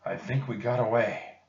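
panting between words; out of breath. Acted. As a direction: speak while still panting.

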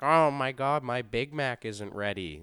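mocking a rude fast-food customer. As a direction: mimic a spoiled customer griping. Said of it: "They're like".

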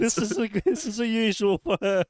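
high, loud, laughing. Real, not acted. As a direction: laugh out the joke. Laughing joke.

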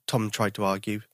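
plain reading voice

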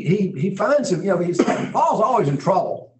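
ordinary talk

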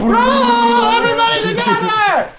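high, loud, drawn-out group cry; rallying shout. Acted. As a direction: call everyone in at full voice. Rallying all players to roll.

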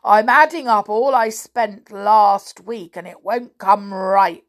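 loud complaint about failing sums; frustrated, forceful storybook giant; exasperated giant. A narrator's reading. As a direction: grumble at full voice.

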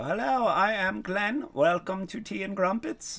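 high, slow, exaggerated British accent; hammed-up, drawn-out British accent, pitched high and loud; Glenn impression. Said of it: "The people wanna hear me go".

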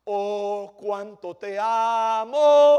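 slow, swelling "cuánto te amo" with "amo" pushed loud. Retold or imitated. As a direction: ham up the fake love, rising to a loud "amo". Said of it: "cristianos que son tan fáciles".

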